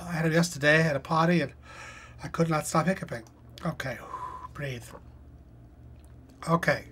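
ordinary talk, nothing marked

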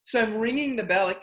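usual speaking voice

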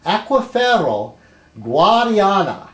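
slow, careful Spanish accent on the place name. Shown as a demonstration. Reading a place name to viewers in a Spanish accent. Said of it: "I'm trying to do my Spanish accent".

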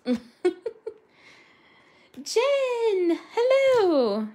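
drawn-out high, loud hello; high, loud happy greeting; excited greeting. Real, not acted. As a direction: sing out a big welcome to a friend. Calls out to arriving viewer.

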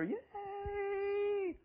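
a high, thin, far-off mock crowd cheer. Preacher mocking the crowd in hell with a high thin cheer. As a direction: squeak out a tiny cheer. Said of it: "thousands of people scream and holler".

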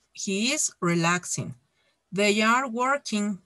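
slow, deliberate English model sentences; example read-out. Shown as a demonstration. Teacher giving the class examples of present continuous, slow read-out. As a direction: space the words out for learners.

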